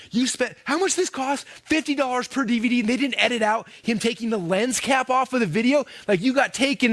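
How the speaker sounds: high-pitched incredulous ribbing; mocking buddies. Buddies teasing him over pricey DVDs, mocking. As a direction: imitate friends making fun of a bad purchase. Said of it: "making fun of me".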